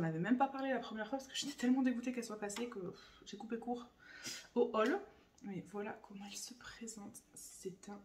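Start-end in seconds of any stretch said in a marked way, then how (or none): 5.4-8.0 hushed whisper; whispered reveal. Real, not acted. Vlogger unveiling her dream palette to viewers in a whisper.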